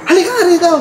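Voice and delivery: high, light call of a grandma. Retold or imitated. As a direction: call the child over, lightly. (tawag ng lola, magaan)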